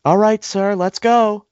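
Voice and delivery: high, loud mock yell of the clerk; retold yell. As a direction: call the next customer sharply. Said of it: "she yells".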